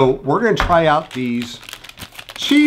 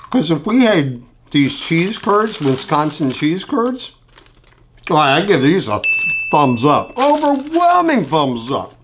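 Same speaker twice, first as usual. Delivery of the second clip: talking around food; chewing while talking. Real, not acted. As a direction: mouth partly full. Seen 2: Curd goes into mouth at -1; jaw keeps working while he talks.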